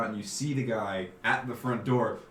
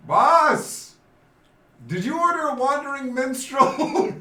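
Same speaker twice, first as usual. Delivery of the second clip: befuddled guard calling; 12 semitones higher, 6 dB louder, slower than usual. Acted. Befuddled guard calls to his boss.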